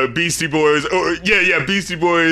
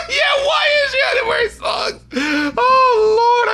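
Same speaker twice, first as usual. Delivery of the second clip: real high-pitched, cackling incredulity; laughing disbelief; slower than usual. Real, not acted. Echoing co-host's complaint about the songs, laughing wail.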